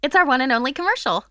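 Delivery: fast, loud promo read; announcer voice. Acted. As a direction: sell it like a radio ad.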